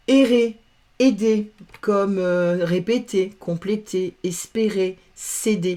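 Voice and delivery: usual speaking voice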